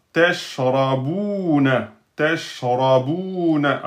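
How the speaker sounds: teshrabuna read slowly, syllable by syllable; teacher modelling the full word; slow model reading. Shown as a demonstration. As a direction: draw out teshrabuna for the class. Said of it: "teacher models word".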